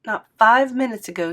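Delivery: usual speaking voice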